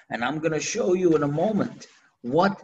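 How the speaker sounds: ordinary talk, nothing marked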